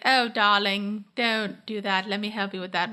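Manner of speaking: posh British imitation, starting slow; Rachel, posh British accent. Podcaster imitates character offering help, posh British accent. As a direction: put on a posh British "darling" voice. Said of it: "like, a posh British accent".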